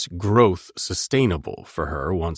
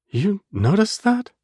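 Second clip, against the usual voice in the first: surprised; 13 semitones higher, slower than usual. Acted. Heroine stunned by his care.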